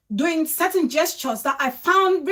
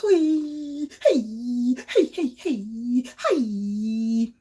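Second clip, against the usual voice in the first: mock wailing hey; 3 semitones lower, much slower than usual. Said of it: "I'm being so cold because the".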